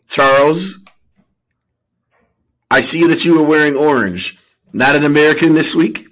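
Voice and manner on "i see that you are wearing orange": ordinary talk, nothing marked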